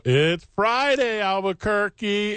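slow, stretched announcer opener, pitched high; hammed-up radio intro; mock radio opener. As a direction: drag it out like a hyped radio host. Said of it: "I'll go".

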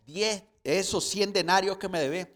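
loud, threatening demand for money; furious servant demanding his hundred denarii; angry demand. Retold or imitated. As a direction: shout at the debtor, choking him. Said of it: "le ahogaba diciendo".

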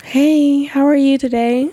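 lightly put-on service greeting; a hint of shop-assistant politeness; customer service voice. Shown as a demonstration. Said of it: "What's your customer service voice?".

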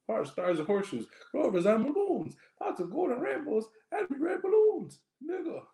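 loud, high Irish jingle chant; attempted Irish accent. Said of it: "do an Irish accent".

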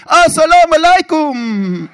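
high, loud, slow bellow; roared formal greeting. Retold or imitated. Mullah and president's secretary rising to answer, roared. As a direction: shout it out long like someone leaping up. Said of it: "bare reiser seg".

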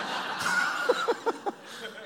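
airy, high laugh at his own embarrassment; real unvoiced laugh; breathy burst of laughter. Real, not acted. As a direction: laugh it off, almost silent.

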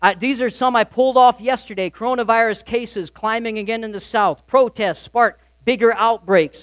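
usual speaking voice

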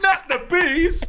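high, loud shriek of horror; terrified scream. Pretend. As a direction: scream as they drag you off. Acted condemned prisoner screaming.

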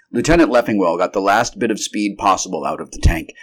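usual speaking voice

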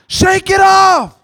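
slow, drawn-out shouted shake it off. Real, not acted. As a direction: shout it slowly, stretching every word. Preacher urging congregation.

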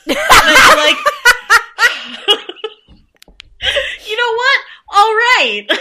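high, loud, talking through laughter; laughing too hard to get the words out; cracking up, real laughter breaking the phrases; helpless laughter. Real, not acted. Cracking up at the joke.

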